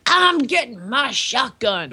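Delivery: slow southern dad impression; drawn-out southern accent, imitating an angry father; extreme southern accent. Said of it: "his extreme southern accent saying that".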